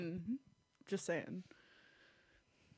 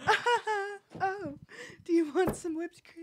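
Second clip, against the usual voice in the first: ditzy voice; 9 semitones higher than usual. Retold or imitated. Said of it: "She's like".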